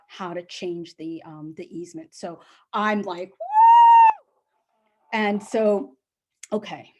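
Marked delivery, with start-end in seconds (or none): 3.3-4.3 very high, loud celebratory whoop. Real, not acted. Celebrating the house sale, loud high whoop. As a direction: let out a joyful whoop.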